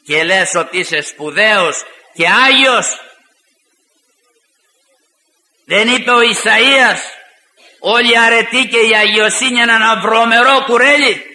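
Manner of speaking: rising fiery rebuke, ending in a shout; impassioned Orthodox sermon; preaching at full force. Acted. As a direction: thunder at the proud sinner.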